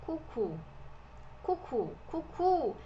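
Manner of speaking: coucou as "koo-koo"; coucou, modelled as separate repetitions; French pronunciation demo. Shown as a demonstration. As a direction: say coucou with two rounded "oo" sounds. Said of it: "e aqui a pronúncia é".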